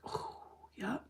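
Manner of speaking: breathy whisper of judgement; whispered verdict. Retold or imitated. Judging the lady's outfit in a whisper.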